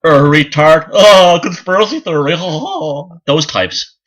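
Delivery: mock the know-it-all debunkers with scorn; mocking skeptics. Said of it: "the license to go".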